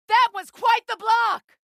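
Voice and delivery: high, loud praise shouted; excited cheer. Acted. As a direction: cheer him on mid-fight. Praising ally in battle.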